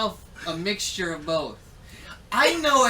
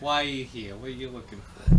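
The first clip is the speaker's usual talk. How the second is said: lightly voiced NPC; 7 semitones lower than usual. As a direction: slightly lower gruff questioning NPC. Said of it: "Why I'm stuck in this voice?".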